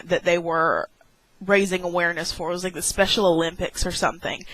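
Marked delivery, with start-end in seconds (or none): none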